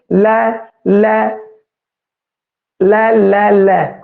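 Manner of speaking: ਲੈ said very slowly and repeated, tongue on the teeth; slow sound demo. Shown as a demonstration. Teacher demonstrating the dental ਲੈ to students. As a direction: draw out each ਲੈ for the class. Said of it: "ਅਗਲਾ ਉਚਾਰਨ ਲੈ ਦਾ ਕਰਕੇ ਦੇਖੀਏ".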